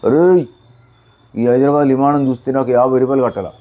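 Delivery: loud, high indignant yell; outraged shout. Acted. Man refusing to pay fifty rupees, yelling in outrage. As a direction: bark the protest at him.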